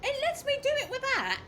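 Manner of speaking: high, loud surprised question. Real, not acted. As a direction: squeak it out in disbelief. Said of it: "Cheeky bastard".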